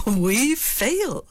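brazen daredevil. Shown as a demonstration. Lady Macbeth boldly dismissing failure as a daredevil. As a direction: toss it off fearlessly. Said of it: "she's the brazen daredevil".

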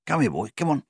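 coaxing the dog over with a high call. A narrator's reading. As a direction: call him over, friendly and loud.

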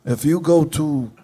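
a hint of Gene pitching his casket; [name] voice. Gene sells the casket to the radio hosts, lightly voiced.

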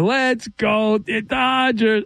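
drawn-out imitation of a kid's chant; little kid voice. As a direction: stretch each word like a small fan cheering. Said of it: "with his cute little kid voice".

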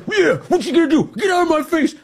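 high, mocking taunt; mocking a brash activist. Host mocking the activist taunting troopers, high squeaky taunt.

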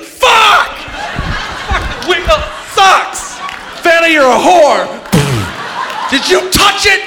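hammed-up furious shriek; mock rage. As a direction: lose your mind screaming at the wheel. Imagines himself losing it.

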